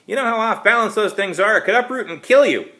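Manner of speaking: high and loud scolding; angry old woman scolding kids loudly; yelling. A narrator's reading. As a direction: keep shouting the warning across the yard. Mary keeps yelling at kids.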